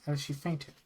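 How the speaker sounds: ordinary delivery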